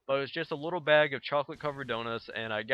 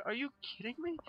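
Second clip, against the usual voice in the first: disbelief; 12 semitones higher, 8 dB quieter, faster than usual. Retold or imitated. Retelling disbelief at the cashier.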